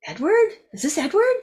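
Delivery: high, rising, startled question; surprised recognition. Retold or imitated. She's surprised to hear the caller is her old client Edward. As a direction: suddenly realize who's calling.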